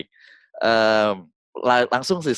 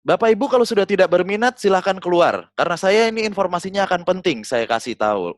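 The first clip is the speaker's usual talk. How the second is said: high, loud bossy warning; mock stern scolding; 9 semitones higher, 4 dB louder than usual. Speaker scolding bored audience members to leave, loud and high.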